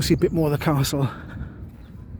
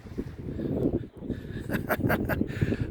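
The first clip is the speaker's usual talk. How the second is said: breathy laugh; 7 dB quieter than usual. Real, not acted. Laughing at the race.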